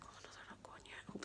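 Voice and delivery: barely voiced, hoping not to be seen; anxious whisper. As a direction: breathe it out so nobody hears. Hiding in the car park.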